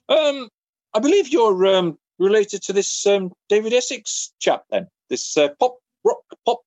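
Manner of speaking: high, halting mock teacher voice; teacher hunting for the pop star's name, high and hesitant; imitating the hesitant teacher. Deputy head voiced in story.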